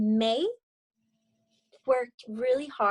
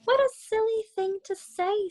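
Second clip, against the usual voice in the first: higher, lightly voiced mom brushing it off; mother's voice; 11 semitones higher than usual. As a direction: play the mom, a touch dismissive. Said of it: "said her mother".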